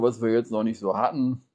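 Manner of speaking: ordinary talk, nothing marked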